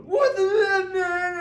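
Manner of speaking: very high, loud, drawn-out acted scream; panicked scream. Actor whose buddy got shot on film, screaming in panic. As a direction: scream it slowly in full-blown fake panic. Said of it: "I start screaming cuss words".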